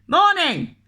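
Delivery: loud, high shouted morning. Acted. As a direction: call it out at the door. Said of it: "shouted the postman".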